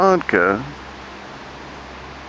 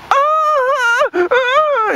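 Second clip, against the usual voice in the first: high mock wail of panic; mock panicked shriek. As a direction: shriek like someone freaking out. Mocking panic over pregnancy.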